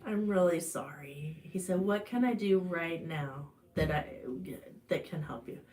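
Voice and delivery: low, soft, soothing voice; calm, gentle. Retold or imitated. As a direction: imitate a husband calmly offering comfort. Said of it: "he calmly said".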